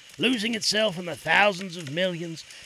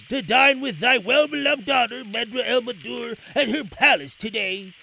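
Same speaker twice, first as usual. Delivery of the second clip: Aladdin voice; 5 semitones higher, 4 dB louder than usual. A narrator's reading. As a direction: address the king reverently.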